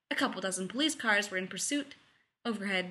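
usual speaking voice

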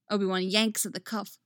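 ordinary delivery, nothing marked

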